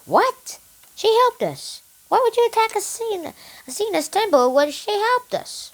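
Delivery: high-pitched, incredulous protest; indignant disbelief. Acted. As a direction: voice jumps up in baffled objection. Soldiers protest in acted quote.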